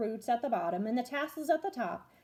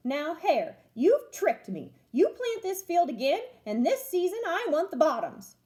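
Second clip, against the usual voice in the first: grumpy bear; 6 semitones higher, 5 dB louder than usual. Acted. Bear angry at being tricked.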